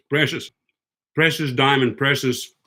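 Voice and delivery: usual speaking voice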